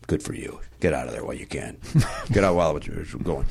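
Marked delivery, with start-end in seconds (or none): 0.1-1.8 whispered advice, low and soft; hushed aside. Real, not acted. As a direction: lean in and whisper it.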